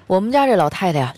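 usual speaking voice

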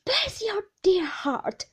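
slow, high, loud blessing; loud, drawn-out endearment; fond cry. A narrator's reading. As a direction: cry it out with affection. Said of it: "cried Peggotty".

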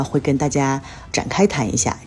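ordinary delivery

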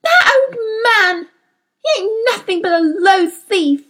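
high, wailing outburst of heartbreak; passionate sobbing. A narrator's reading. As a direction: sob out the grievance as if your heart is breaking. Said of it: "she sobbed so passionately".

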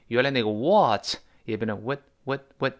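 usual speaking voice

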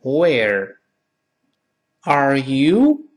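very slow, separated words for repeating; slow model, rising on YOU; slow read-aloud. Shown as a demonstration. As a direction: draw out WHERE, then lift ARE YOU. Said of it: "我们大声读一遍".

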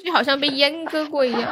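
ordinary talk

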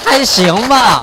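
high, loud puppyish yelp. Acted. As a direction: yap it out like an eager puppy. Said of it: "不要再学小奶狗了啊".

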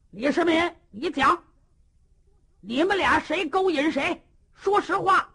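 high, loud questioning; hectoring demand. Acted. Cheng Yaojin grilling Li Shimin, loud and hectoring. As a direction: bark the question at him.

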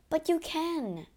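high, slow, earnest insistence; insistent. A narrator's reading. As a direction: press it on him, drawn out. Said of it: "Christine insisted".

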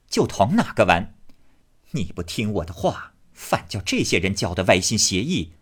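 put-on a commanding lady tells off a sulky boy; scolding elder sister-in-law. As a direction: scold him hard, then wave it off. Xifeng scolds Jia Huan.

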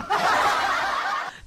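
laugh. Real, not acted. Laughs at own story.

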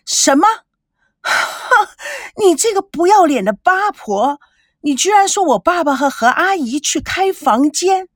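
furious outburst. Acted. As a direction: explode at the insult. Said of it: "孙娜恨不得打他两个耳光". (怒不可遏地骂)

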